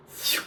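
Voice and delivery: airy whistle of a shot; imitated dart whoosh. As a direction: mimic a dart flying off.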